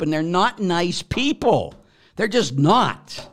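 fired-up podcast rant; heated rant. Real, not acted. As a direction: preach it out with rising indignation. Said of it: "sorry for my rant".

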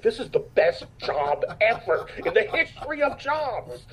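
high, slow, drawn-out rave; retold elation. Actor recalls winning a thousand dollars on a job, high elated voice. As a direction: stretch each word in mock-ecstatic awe. Retelling delight at casino win.

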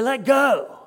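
put-on high, loud little-boy demand; demanding small child. Small son in the wave pool to his dad, demanding. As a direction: imitate a kid insisting, pitched well up.

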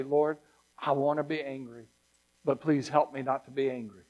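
quiet prayer. Pretend. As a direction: pray it low and soft, holding back anger. Soft prayer when wanting anger.

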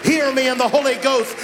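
full-throated revival preaching; preaching at full force. Acted. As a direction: drive the congregation toward the altar call. Sermon climax.

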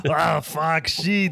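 hammed-up Australian cursing with a laugh; mock Australian, veering toward Austrian; bad Australian accent. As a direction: swear in an overdone fake Australian accent. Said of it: "you sound like Arnold Schwarzenegger right now".

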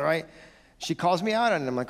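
ordinary delivery

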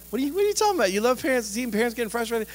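high incredulous objection; mock disbelief. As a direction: squeak it like a shocked listener. Imagined listener objecting.

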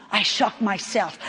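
normal talking voice

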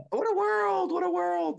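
high, slow, hammed-up witch wail; Wicked Witch voice. Podcaster quoting The Wizard of Oz, high slow witch wail.

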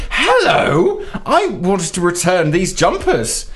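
high, slow, overly polite; mock polite. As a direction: play the too-sweet customer. Retold polite opening to clerk.

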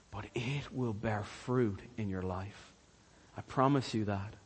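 low, soft and solemn; earnest promise. Real, not acted. Preacher assuring his congregation of fruit, hushed and earnest. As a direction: drop low and quiet, let each promise land.

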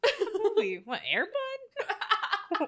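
high, slow, laughing disbelief; laughing mock question. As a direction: ask it amused, nearly giggling. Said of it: "I just immediately was like".

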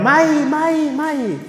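high, loud anguished cry; crying out in fear. Retold or imitated. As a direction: wail it out like a frightened person. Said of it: "And we cry out".

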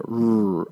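"R" as a long drawn "rrr" sound; strong R sound. Shown as a demonstration. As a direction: hold the "R" as a pure consonant sound. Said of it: "is the strong R sound".

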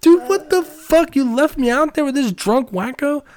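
loud, very high, outraged squeal; re-enacted indignant outburst at a friend; retold furious protest. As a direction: hammer up the pissed-off disbelief, high and loud. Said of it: "I am beyond pissed".

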